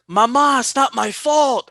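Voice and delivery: high, slow whiny plea to his mother; mock childish whimper to mom; whiny mama's boy. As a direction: whine it like a kid blaming anyone else. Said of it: "He's like".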